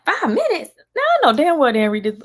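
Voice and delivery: high, loud scoff of disbelief; incredulous. Retold or imitated. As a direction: shout it like you can't believe it. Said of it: "I'm like".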